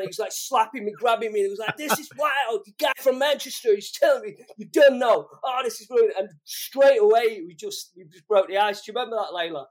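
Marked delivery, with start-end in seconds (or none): 1.8-6.1 high, loud, incredulous imitation; retold delight. Retelling [name] amazed by the guy from Manchester, excited imitation. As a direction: marvel at it, thrilled and disbelieving.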